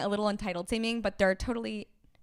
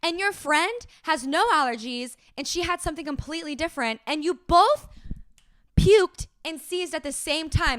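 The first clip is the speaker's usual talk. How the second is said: loud, higher, sassy retold scolding; indignant telling-off; 4 semitones higher, 5 dB louder than usual. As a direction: lay into them, point by point. Retold scolding of blaming customers.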